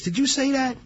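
high, startled burglar's question. Retold or imitated. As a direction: ask the dark, alarmed and high-pitched. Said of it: "He goes".

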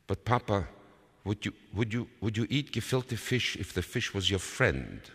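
put-on high, stammering child's question; hesitant child. A narrator's reading. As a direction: stumble into a careful question to father. Child hesitantly tests father.

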